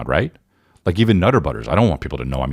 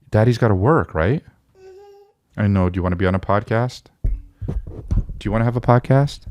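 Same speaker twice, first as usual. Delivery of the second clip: high, gentle sing-song; 5 semitones higher than usual. Real, not acted. Father talking to baby.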